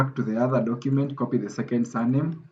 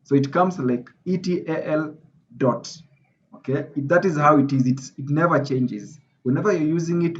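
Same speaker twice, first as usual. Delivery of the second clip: usual speaking voice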